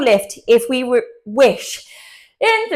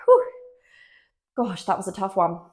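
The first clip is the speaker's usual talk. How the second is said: winded after the set; catching breath, tired; after a workout; 3 semitones lower, 5 dB quieter than usual. Real, not acted. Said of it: "Couldn't speak then".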